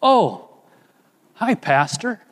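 oh, caught off guard; surprised. Retold or imitated. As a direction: neighbour suddenly recognizing his pastor. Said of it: "He said".

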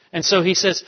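normal talking voice

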